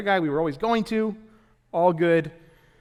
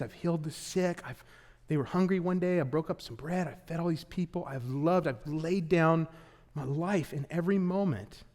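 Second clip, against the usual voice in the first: tender Jesus; 6 dB quieter than usual. Acted. Speaking as Jesus, soft.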